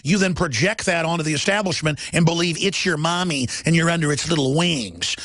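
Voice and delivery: ordinary talk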